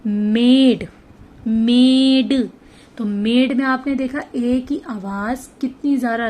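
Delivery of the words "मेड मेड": मेड with the e drawn out long and loud; loud clear vowel. Shown as a demonstration. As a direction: stretch मेड out, very slow and loud. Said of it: "उसकी आवाज़ अब आपको proper आएगी". (मेड, लंबा और ज़ोर से)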